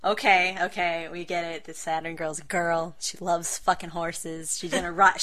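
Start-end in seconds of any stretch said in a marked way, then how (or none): none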